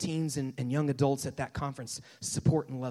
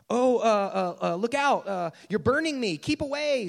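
loud, higher, stammering recoil; alarmed ghost; 5 semitones higher, 6 dB louder than usual. Acted. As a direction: cry out as if backing away from heat. Acted ghost recoils from the burning angel.

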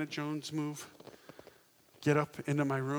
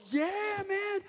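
much higher, thrilled yeah man; boy thrilled the girl's car will take him, squeaky high; excited yes. Retold or imitated. Excited yes to theme park.

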